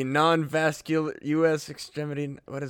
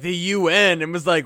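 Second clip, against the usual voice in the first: normal talking voice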